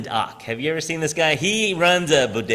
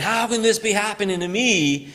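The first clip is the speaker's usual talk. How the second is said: higher, mock lament; despairing; 8 semitones higher than usual. A hurting person lamenting to heaven. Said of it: "instead of saying".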